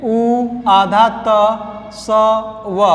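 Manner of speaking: उ आधा त स व spelled slowly, letter by letter; spelling it out. Shown as a demonstration. Teacher spelling उत्सव for the class. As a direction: spell out each letter with pauses. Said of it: "देखिए कितनी गलतियाँ होती हैं".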